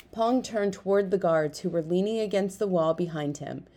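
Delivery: ordinary delivery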